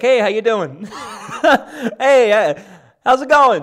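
high, loud, cheery mock greeting with a laugh; mock friendly greeting. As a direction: wave hello in an exaggerated sunny voice. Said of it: "everybody's just like".

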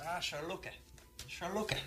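put-on real Irish speaker's accent; soft, quick Irish delivery. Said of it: "here's someone with an Irish accent".

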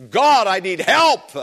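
loud, desperate plea to God, pitch high; passionate cry for help. Acted. Preacher acting the psalmist crying out to God, loud and desperate. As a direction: cry out to God like you're at the end of your rope. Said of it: "I'm just passionately".

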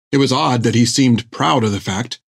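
usual speaking voice

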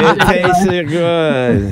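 tipsy drawl. Pretend. Drunk taster voice.

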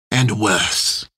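put-on lightly ominous French-accented relish; savouring. A narrator's reading. Captain ends his list of criminals, ominous relish. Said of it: "enjoying the taste of each one".

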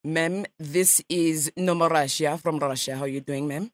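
a light mock Russian accent, nasal ma'am; radio prank caller in a lightly faked Russian accent; put-on Russian accent. Prankster calls a stranger as a Russian journalist, mock Russian accent. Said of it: "I speak Russian".

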